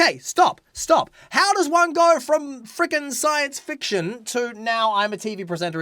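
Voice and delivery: hammed-up stop and ask; dramatic loud. Dramatic mock interruption.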